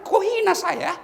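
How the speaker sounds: high, loud indignant rich man; offended rich man. Retold or imitated. Rich man insulted by a small charity gift, indignant outcry. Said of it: "Orang kaya dia marah".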